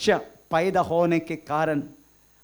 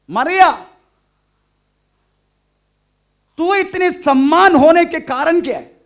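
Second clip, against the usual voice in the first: loud, high preacher's question thrown out to Mary; calling on Mary; 11 semitones higher, 11 dB louder than usual. Pretend. Preacher questions Mary about her great honour, calling out loudly. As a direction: call out a question as if Mary could hear. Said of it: "हम मरिया को पूछेंगे".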